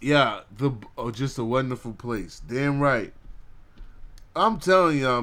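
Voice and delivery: usual speaking voice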